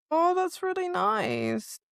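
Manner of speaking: ordinary talk, nothing marked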